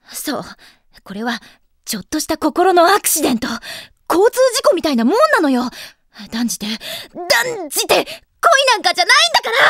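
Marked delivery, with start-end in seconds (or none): 1.8-9.6 loud, high, building protest; flustered denial. Acted. Girl insisting it is not love, loudly flustered. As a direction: insist ever louder until it bursts out.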